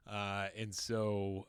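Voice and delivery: ordinary talk, nothing marked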